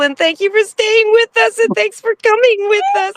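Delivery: thanks pouring out in a giddy rush, pitch soaring; real overflowing excitement. Real, not acted. Fan thanking Sass, can't contain her excitement. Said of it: "And I'm just so excited".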